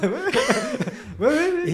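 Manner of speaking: delighted laughing yeses; giggly excited agreement. Real, not acted.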